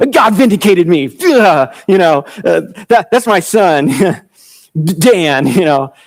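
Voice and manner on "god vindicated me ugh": lightly sing-song smug boast with a groan; gloating Rachel. Retold or imitated. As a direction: crow over the win, sing-song. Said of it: "'Cause it's like".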